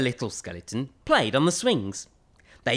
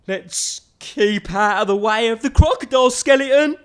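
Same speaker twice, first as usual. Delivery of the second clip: higher, louder, mock-nervous; wary big skeleton; 7 semitones higher, 6 dB louder than usual. Big skeleton warns about the crocodile skeleton. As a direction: warn to steer clear.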